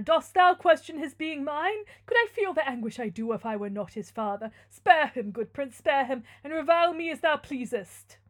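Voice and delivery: loud, theatrical begging; anguished plea. Acted. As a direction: implore the prince as a desperate father. Father pleads for son's life.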